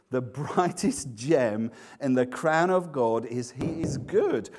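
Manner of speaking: normal talking voice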